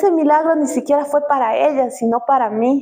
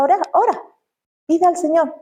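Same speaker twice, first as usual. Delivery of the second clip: higher, pressing plea to pray; earnest urging; 6 semitones higher than usual. Retold or imitated. To her childless friend, a higher earnest plea. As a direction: urge her to pray, voice lifted.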